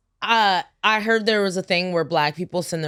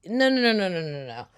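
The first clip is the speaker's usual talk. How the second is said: rapid run of no's, trailing away softer; dismissive refusal; 4 dB quieter than usual. Acted. Answering guys who claim to be moderate, dismissive. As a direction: cut them off with a string of no's. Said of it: "I'm like".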